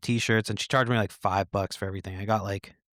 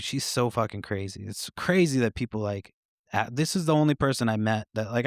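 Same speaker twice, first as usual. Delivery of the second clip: normal talking voice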